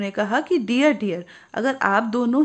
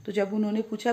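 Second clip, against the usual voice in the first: ordinary delivery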